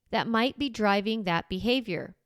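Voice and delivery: ordinary talk, nothing marked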